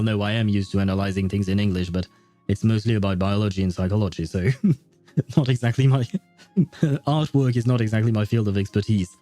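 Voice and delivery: ordinary talk, nothing marked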